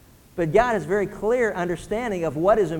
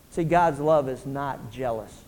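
ordinary talk, nothing marked

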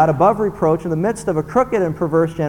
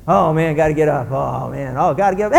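imitating a morning moaner; moaning and groaning; 3 semitones lower than usual. Grumbler who has to get up, moaning. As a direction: groan through it like getting up is torture. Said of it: "people moaning and groaning about everything".

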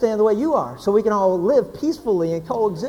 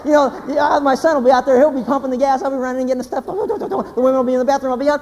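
ordinary talk

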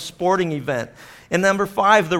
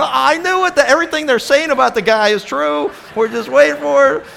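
much higher, louder know-it-all jeer; mock skeptical listener; 11 semitones higher, 7 dB louder than usual. Preacher voicing a skeptical congregant, high and jeering. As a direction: play the doubter who says told you so. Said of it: "Some of you are going".

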